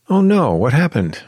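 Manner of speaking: high, loud alarmed concern; worried. Acted. Clara reacting to her friend's bad news, worried. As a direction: ask in sudden alarm. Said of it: "worried Clara".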